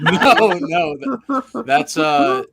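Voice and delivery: loud, high, laughing no; laughing protest. Real, not acted. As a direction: burst out laughing while objecting.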